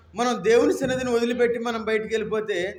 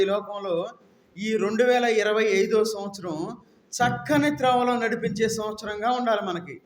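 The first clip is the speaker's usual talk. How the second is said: usual speaking voice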